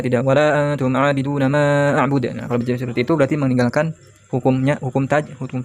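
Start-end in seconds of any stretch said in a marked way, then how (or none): 0.3-2.3 rushed reading, long vowels cut short; dibaca cepat-cepat. Shown as a demonstration. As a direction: rattle it off without holding the vowels. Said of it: "tidak dibaca cepat-cepat ya". (bacaan terburu-buru tanpa mad)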